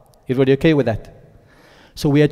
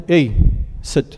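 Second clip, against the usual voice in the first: short, abrupt order with a pause after Hey; curt command. Shown as a demonstration. Teacher demonstrating a blunt order to students, curtly.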